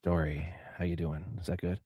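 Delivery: low, soft seductive voice; sexy voice. Pretend. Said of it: "I'll do it in a sexy voice".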